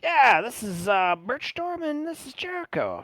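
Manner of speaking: high, loud hail sliding into introductions; chummy guide making introductions. Acted. Voices Billy introducing companions.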